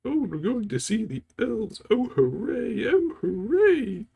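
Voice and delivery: shrill-free but high, loud mock cartoon cheer from the old animated film; Bakshi cartoon Sam. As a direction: squeal it like an overexcited cartoon hobbit, slowing on the last hooray. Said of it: "Ralph Bakshi version of Sam".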